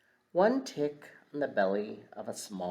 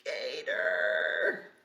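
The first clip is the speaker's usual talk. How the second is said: storybook frog's alarmed shout; frog screams; 4 dB louder than usual. A narrator's reading. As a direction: make the frog yell out in panic. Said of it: "the frog screams".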